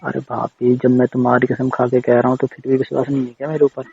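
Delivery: ordinary talk, nothing marked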